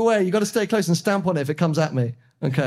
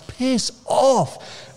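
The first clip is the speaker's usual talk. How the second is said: high, irritated mock snap at the car behind; imitating telling a tailgater to back off; annoyed brush-off; 8 semitones higher than usual. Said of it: "I'm just like".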